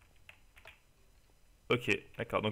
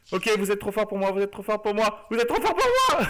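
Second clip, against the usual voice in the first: overwhelmed; 7 semitones higher than usual. Real, not acted. Overwhelmed by mobs, repeating.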